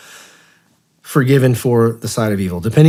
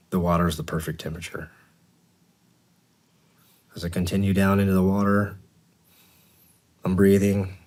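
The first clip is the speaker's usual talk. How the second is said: guided meditation. Acted. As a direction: talk them gently down to the beach.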